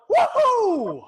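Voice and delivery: high, loud whoop of triumph; boy whooping after snatching the pebble; victory whoop. Acted. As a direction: let out a cheer like you scored. Boy celebrates winning.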